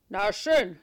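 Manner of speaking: loud gruff terrier; barking dog voice. A narrator's reading. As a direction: bark the words like a dog. Dog character.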